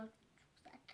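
whispered "Çok sert", no voice. Shown as a demonstration. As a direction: breathe "sert" softly. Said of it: "Çok sert uyuyor".

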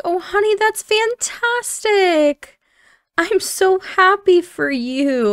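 high, drawn-out gushing delight; high-pitched slow squeal of happiness; overjoyed mom. Acted. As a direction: gush with joy at a daughter's big news, stretching the words. Mom reacts to pregnancy news.

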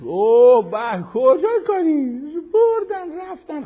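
loud, high, slow mock exclamation; mock outcry. Preacher mocks a sinner brushing off guilt, in a loud mock outcry. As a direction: ham up a scandalised cry.

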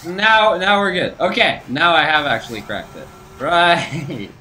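loud high triumphant cheer. Real, not acted. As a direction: celebrate cracking it. Solved the puzzle.

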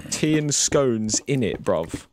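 lightly hammed-up London accent; mock British accent. Streamer playing a British possessor, jokey British accent. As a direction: drop in a cheeky British bruv. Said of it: "Gonna turn British".

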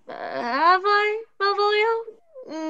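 drawing out the name in dismay; astonished protest to Malvolio; shocked disbelief. Acted. Olivia shocked at accusation.

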